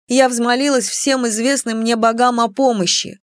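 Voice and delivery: usual speaking voice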